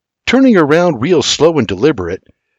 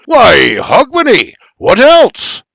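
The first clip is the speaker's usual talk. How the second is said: triumphant Scottish shout, much higher; exclaiming; 11 semitones higher, 6 dB louder, slower than usual. Acted. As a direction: proclaim it gleefully. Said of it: "the old Scot exclaims".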